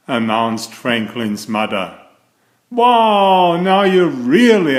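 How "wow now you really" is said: loud high wow, then bright and eager; delighted for his friend; excited. A narrator's reading. Franklin congratulating Bear on his new sister, excited.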